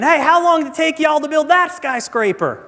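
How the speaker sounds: high-pitched, nosy tourist question; Texan tourist piping up. Acted. Texan asking the tour guide about a skyscraper, piping up high. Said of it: "the Texan pipes up again".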